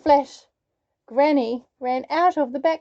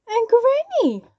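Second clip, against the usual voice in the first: put-on careful girl voice; 11 semitones higher, 6 dB louder than usual. A narrator's reading. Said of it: "Little Red said carefully".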